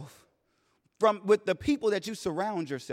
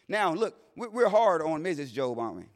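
ordinary talk, nothing marked

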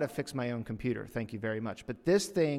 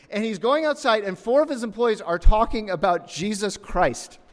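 higher and louder, building preacher excitement; excited; 9 semitones higher, 9 dB louder than usual. Real, not acted. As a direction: let the story boil over with excitement. Excited climax of the story.

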